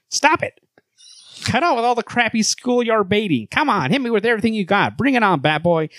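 high-pitched, exasperated hero; Superman. Acted. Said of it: "a higher voice for Superman".

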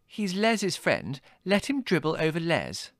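high, drawn-out sulky protest; indignant sister. A narrator's reading. Margot pushing the guest onto her brother, peeved. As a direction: pitch it high and aggrieved. Sister sulking in acted quarrel.

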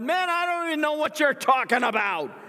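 very high, loud exasperated denial; furious denial. Acted. Peter's third denial to accuser, high loud exasperation. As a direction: snap back, fed up and loud.

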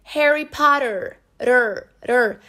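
slow "Harry Potter", then the tapped "Rr" alone; "Potter" with its T turned into R; American flapped T. Shown as a demonstration. Teacher isolating the sound for learners, flapped T. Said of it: "Aí eu tenho um double T".

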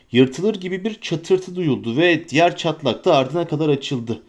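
plain reading voice

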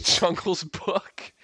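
high, slow laughing repeat. Real, not acted. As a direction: laugh through the words. Laughing repeat of friend's phrase.

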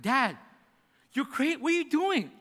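put-on a scared boy's alarmed cry; panicked son.

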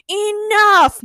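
high, loud scream of command. A narrator's reading. Villain cuts off the cake squabble, screaming. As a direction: scream to silence the room. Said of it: "Nurse screamed".

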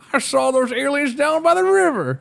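high, put-on old prospector voice; an old gold miner, spinning a yarn. Shown as a demonstration. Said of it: "I played an old prospector".

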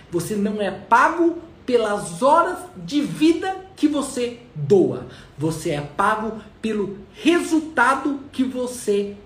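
slow, loud, emphatic speech; motivational preaching. Acted. Speaker preaching to his audience about being paid for results, slow emphatic delivery. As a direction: hammer each phrase home slowly to the audience.

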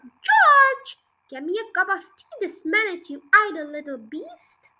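a high shout of the name, fading to bossy talk; yelling. Acted. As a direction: shout the name, then command. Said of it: "she yelled".

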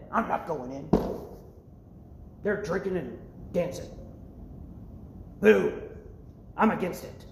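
understated, grouchy imitation; mock sulky anger. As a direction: dig in like a sullen brother. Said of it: "He got angry".

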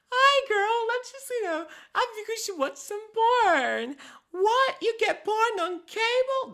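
very high, loud squeaky cartoon voice; squeaky vagina character. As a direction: play the puppet girlfriend, giddy and shrill-high. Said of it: "you got a vagina going like".

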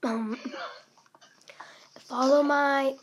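usual speaking voice

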